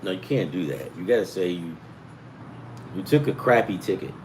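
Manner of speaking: ordinary talk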